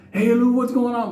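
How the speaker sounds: high, eager call to a friend; excited fan greeting. Retold or imitated. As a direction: hail him like a fan spotting a star. Fans greeting him on street.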